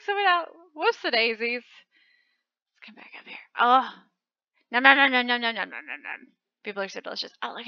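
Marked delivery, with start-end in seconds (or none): 1.6-3.4 slow breathy whisper. Real, not acted. 4.7-6.3 eating noises. Pretend.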